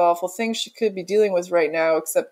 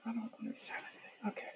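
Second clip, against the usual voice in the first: breathy unvoiced whisper; 3 semitones higher, 21 dB quieter, faster than usual. Real, not acted. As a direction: whisper it to yourself.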